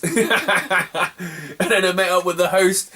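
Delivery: slow words broken by laughter; laughing. Real, not acted. Telling a mate about the host, laughing. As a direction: laugh through it while telling the host part.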